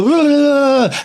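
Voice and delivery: high, loud mock hyper babble; hyper babbling. Son mocking the food-colouring high with loud babble. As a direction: ham up a sugar-rushed kid babbling. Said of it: "it makes you go".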